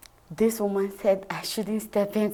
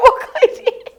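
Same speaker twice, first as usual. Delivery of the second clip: much higher and louder, bursting into laughter on the name; laughing name; 13 dB louder than usual. Real, not acted. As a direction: blurt the name out while laughing.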